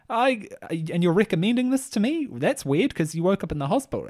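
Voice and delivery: high-pitched, baffled objection; mock incredulous woman. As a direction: imitate her taken aback, voice jumping up high. Retold woman questions a diet pitch.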